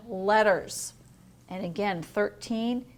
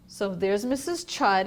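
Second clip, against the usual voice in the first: usual speaking voice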